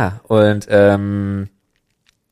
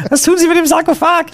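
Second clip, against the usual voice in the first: much higher silly voice; high squeaky voice; 4 dB louder than usual. Pretend. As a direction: squeak it like a cartoon. Mock detective game, very high.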